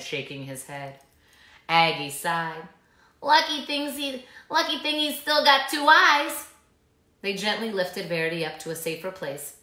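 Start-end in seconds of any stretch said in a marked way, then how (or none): 3.2-6.5 lightly voiced old snake. A narrator's reading.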